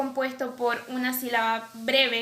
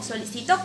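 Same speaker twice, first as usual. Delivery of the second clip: "solicitó" stressed on final TÓ; stress on last syllable. Shown as a demonstration. Teacher contrasting accent positions, stress on TÓ in "solicitó". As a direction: land on the TÓ in "solicitó". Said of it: "el acento está en distintas sílabas".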